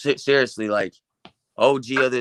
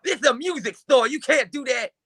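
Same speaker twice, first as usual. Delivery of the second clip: imitating Dope Fiend furiously ordering the moshers to stop; mad yelling; 11 semitones higher than usual. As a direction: yell like an outraged store boss. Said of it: "He's all mad".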